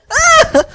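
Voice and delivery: high loud startled cry; high loud yelp of surprise; high surprised yelp. Acted. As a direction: yelp high as you're thrown. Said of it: "he shouts in surprise".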